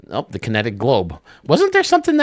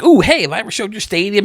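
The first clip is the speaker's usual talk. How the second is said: eager greeting; 11 semitones higher, 5 dB louder, faster than usual. Retold or imitated. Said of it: "I'd be like".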